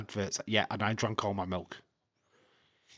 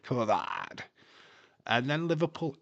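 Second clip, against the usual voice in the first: ordinary talk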